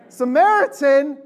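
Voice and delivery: loud, high, drawn-out incredulous question; scandalized disbelief. Acted. Original listeners interrupt Jesus about the parable, scandalized disbelief. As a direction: blurt it out in hammed-up outrage.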